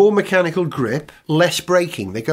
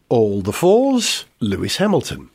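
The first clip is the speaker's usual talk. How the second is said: bingo caller; 7 semitones lower than usual. Pretend. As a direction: call it like a bingo hall. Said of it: "You could do F one bingo".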